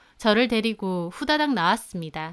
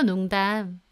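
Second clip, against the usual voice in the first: ordinary delivery, nothing marked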